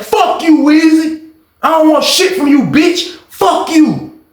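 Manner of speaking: high, heated rejection; angry cursing. Retold or imitated. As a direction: spit the words at him. Unc cursing narrator through window.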